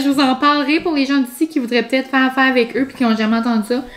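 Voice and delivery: usual speaking voice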